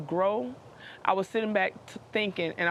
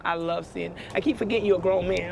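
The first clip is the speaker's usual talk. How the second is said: normal talking voice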